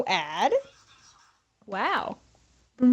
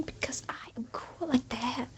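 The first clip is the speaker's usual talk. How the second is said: whisper; 3 semitones higher, 13 dB quieter than usual. Pretend. Bragging aside while starting a mission.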